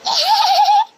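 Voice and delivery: high burst of laughter; loud laugh. Real, not acted. Kids playing, bursts out laughing.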